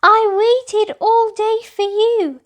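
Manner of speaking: high, loud indignant cry; exclaimed. A narrator's reading. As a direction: fire it straight back at her. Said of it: "exclaimed Little Sister Tahara".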